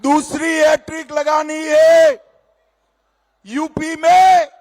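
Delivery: shouting. Real, not acted. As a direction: rouse the crowd loudly. Rally speech rousing crowd.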